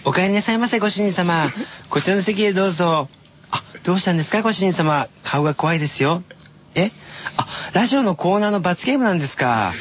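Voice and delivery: high sweet maid voice; sugary maid cafe greeting. Acted. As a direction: play a cutesy cafe maid. Maid cafe skit read by S0. (甘いメイド喫茶の声)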